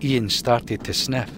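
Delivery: plain reading voice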